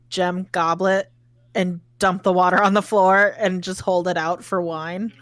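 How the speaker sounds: normal talking voice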